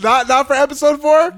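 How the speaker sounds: high, loud, incredulous; excited high question. Real, not acted. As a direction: ask it in amused disbelief.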